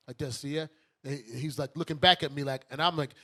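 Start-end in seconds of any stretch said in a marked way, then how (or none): none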